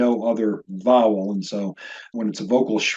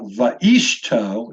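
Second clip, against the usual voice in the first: va'ishto drawn out as va-EESH-to; stress on middle syllable; 3 semitones lower, 3 dB louder than usual. Shown as a demonstration. Teacher shows another wrong stress, slowly stressing ISH in va'ishto. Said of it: "it's not va'ishto".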